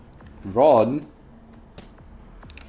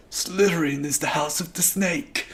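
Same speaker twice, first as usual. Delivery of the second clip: a hint of spooky boy; 7 semitones higher, 4 dB quieter than usual. Albus warns his father, lightly spooky boy voice. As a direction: say it like a scared kid.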